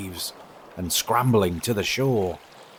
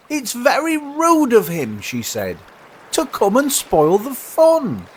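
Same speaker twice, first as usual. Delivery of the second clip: sulky moon; 5 dB louder than usual. Acted. Moon complaining the sun spoils the fun, sulky. The moon's quoted complaint in poem.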